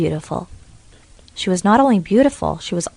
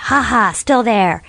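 slow, louder ha ha of a smug witch; gloating witch; 4 semitones higher, 5 dB louder, slower than usual. Acted. Witch tugging the rope, slow smug gloat. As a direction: savour each tug.

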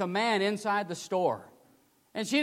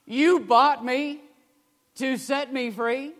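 louder, higher, slow tearful question; tearful cry; 6 semitones higher, 4 dB louder, slower than usual. Retold or imitated. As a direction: cry it out in disbelief. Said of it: "through weeping tears".

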